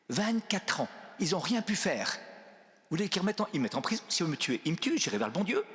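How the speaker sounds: high-pitched, amused retelling; imitated laughing defiance. Old bishop shrugging off prison, imitated high amused voice. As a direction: imitate someone laughing off the danger. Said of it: "il éclate de rire".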